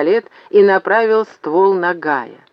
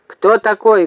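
high, loud challenge; barking; 5 semitones higher, 5 dB louder than usual. A narrator's reading. Зеф questions Максим about the stranger, barking. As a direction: bark out the question. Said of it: "гаркнул он".

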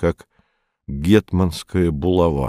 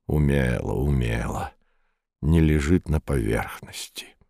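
low, soft, reluctant approval; Маляев, grudging praise; 6 semitones lower, 7 dB quieter than usual. A narrator's reading. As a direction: squeeze the compliment out unwillingly, dropping low and quiet. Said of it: "А Маляев нехотя выдавит".

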